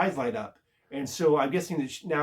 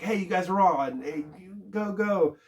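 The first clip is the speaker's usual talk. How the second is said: higher-pitched hustling call; lightly voiced circus boss; 5 semitones higher than usual. Head guy of the circus calls the acts on, mock hustling. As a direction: imitate a boss hurrying the acts along.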